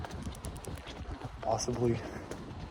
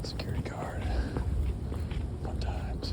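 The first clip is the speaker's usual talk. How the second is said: whispering; 3 dB quieter than usual. Real, not acted. Sneaking past a security guard.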